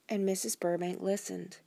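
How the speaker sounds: ordinary delivery, nothing marked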